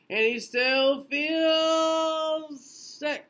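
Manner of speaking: high, slow sing-song refrain line; drawn-out refrain. A narrator's reading. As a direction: stretch the refrain up high for the kids.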